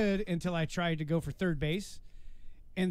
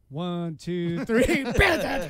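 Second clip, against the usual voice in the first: band count-in; 10 dB louder, much slower than usual. Retold or imitated. Musician restarting band practice after the breakup, shouted count-in. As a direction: count off, then explode into the downbeat.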